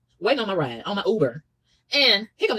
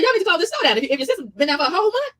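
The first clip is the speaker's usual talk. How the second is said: indignant outburst; 9 semitones higher than usual. Retold or imitated. Telling off the store clerk, high and outraged. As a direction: demand it in outrage. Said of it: "I said".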